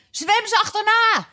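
very high acted screech; Gryphon's high-pitched cry. A narrator's reading. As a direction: screech the order in high excitement. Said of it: "krijste de Griffioen".

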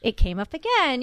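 normal talking voice

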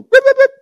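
very high, loud yips; mock dog barking; imitated yapping dog. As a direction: yap like an excited little dog. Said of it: "our dog will".

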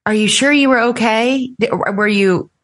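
sugary probing parent voice; mock-worried ex. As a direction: lay the worry on thick to plant doubt. Ex's leading questions to children.

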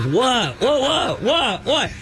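high, rising-pitched protest; defensive what. Retold or imitated. Husband protesting to his wife, high what. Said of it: "And he's like".

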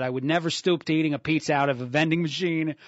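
put-on a light New York accent, reading the listener's text. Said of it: "read his text in a, a New York accent".

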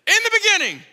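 high, loud triumphant yell; excited kid's answer. Retold or imitated. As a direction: shout it out like a kid winning trivia. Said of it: "so I ran to concierge and I said".

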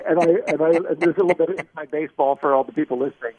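ordinary delivery

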